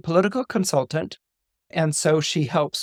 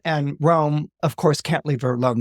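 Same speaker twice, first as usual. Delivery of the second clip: normal talking voice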